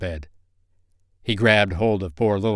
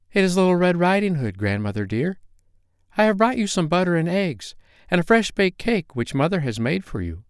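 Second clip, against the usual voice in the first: put-on little girl voice; 9 semitones higher than usual. A narrator's reading. Girl answers at door.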